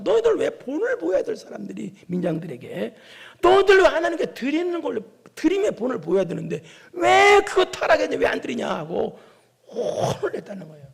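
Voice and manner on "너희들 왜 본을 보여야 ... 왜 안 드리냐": raised, forceful reprimand; acted scolding. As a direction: demand an answer as if rebuking leaders. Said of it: "그러면서 이제 책망, 불러다 놓고 책망". (호되게 책망하듯이)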